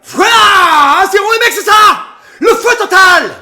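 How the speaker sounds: loud, high, crying out with joy; ecstatic goal shout. Real, not acted. Fan celebrating Marseille's goal with the stream, ecstatic roar. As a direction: roar it like the winning goal just went in.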